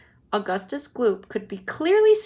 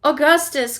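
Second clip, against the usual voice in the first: loud, raised call of the name; crying out; 5 semitones higher, 9 dB louder than usual. Acted. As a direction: call him sharply. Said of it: "cried Mister Wonka".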